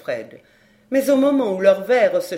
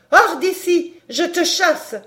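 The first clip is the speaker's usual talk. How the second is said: much higher and louder, furious; shouting; 8 semitones higher, 5 dB louder, slower than usual. A narrator's reading. Countess chasing the maid out, shouting angrily. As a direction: throw her out with a shout.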